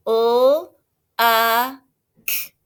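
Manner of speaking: each sound of "uh" stretched apart. Shown as a demonstration. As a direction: tap out U, uh, k slowly. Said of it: "Help me tap it out".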